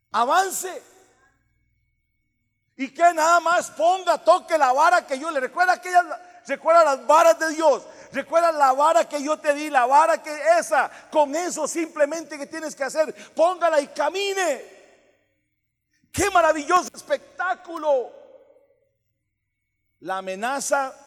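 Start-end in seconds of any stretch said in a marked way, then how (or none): 0.1-0.8 high, loud single-word order; shouted command. Real, not acted. 2.8-18.1 loud, building sermon cry; vurig preken. Real, not acted. Preaching at full force.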